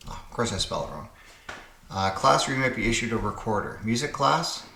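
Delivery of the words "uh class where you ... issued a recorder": ordinary talk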